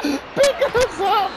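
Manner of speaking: stretched high laughing shout; laughing yell. Real, not acted. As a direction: drag it out laughing. Laughing plea for pickup.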